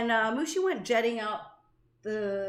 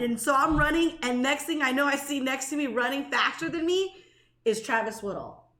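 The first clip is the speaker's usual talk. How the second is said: normal talking voice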